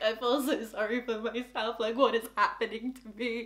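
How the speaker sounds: soft, small, sorry-for-yourself voice; hammed-up dejection, under the breath; mock self-pity. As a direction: mope it quietly, feeling sorry for yourself. Acting meme of walking out crushed.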